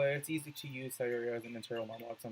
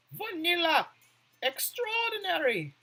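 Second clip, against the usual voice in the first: cutesy squeal; 14 semitones higher, 5 dB louder than usual. Real, not acted.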